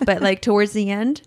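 normal talking voice

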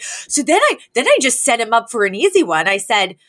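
lifted high, a fussy socialite's retelling; a socialite rehashing a spat, high and theatrical; high dramatic Cece. Retold or imitated. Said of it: "She's like".

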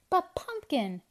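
high, upset protest; dismayed cry. A narrator's reading. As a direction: object in dismay. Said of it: "cried Anton in dismay".

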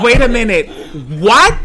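loud, high disbelieving burst; can't believe what he heard; stunned. Retold or imitated. Retelling his shock to the lady.

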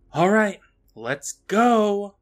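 loud, high, slow mock call; imitated director. Director giving orders to his assistant, imitated loud call. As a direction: stretch it out like a director rallying the set. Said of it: "tells his assistant".

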